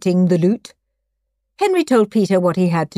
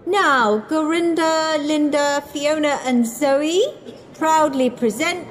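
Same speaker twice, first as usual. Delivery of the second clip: high-pitched, grand introduction; stage announcer; 8 semitones higher than usual. A narrator's reading. Presenting the cushion dance to parents, announcing.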